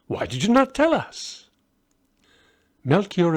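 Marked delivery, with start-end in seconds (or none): 0.1-1.5 surprised Balthazar. Acted.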